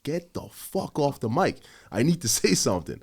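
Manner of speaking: re-yell it, laughing at yourself; retold yell. Cop reenacting yelling at officers on the radio, laughing mock yell. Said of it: "I fucking yelled".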